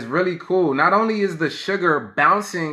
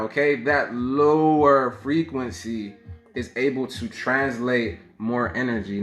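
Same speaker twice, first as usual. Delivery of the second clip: ordinary talk, nothing marked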